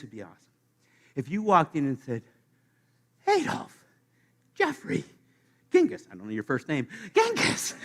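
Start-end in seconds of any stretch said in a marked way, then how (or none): 3.3-6.1 much higher, cheery calling of names; mock cheery greeting. Newcomer cheerily greets Hitler and Dahmer in heaven, much higher. 7.1-7.7 much higher, laughing out the name; laughing greeting. Pretend. Newcomer greets Genghis Khan in heaven, laughing.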